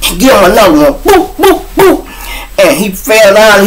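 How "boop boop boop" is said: high squeaky boops for each hit; punch sounds. Retold or imitated. Hitting him again, high boop punch noises.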